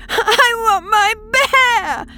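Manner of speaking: drawn-out, high, loud wail; sobbing child. A narrator's reading. Young Polly demands her bear back, sobbing. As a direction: wail it slowly like a tearful little girl. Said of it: "she sobbed".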